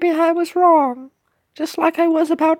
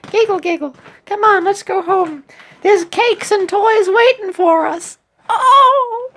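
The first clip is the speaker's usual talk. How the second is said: put-on British voice, lifting to a high cry; cheerful British cookie; 3 semitones higher, 4 dB louder than usual. Cookie calls friend home for cakes, cheerful British voice. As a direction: play an eager cookie heading for treats.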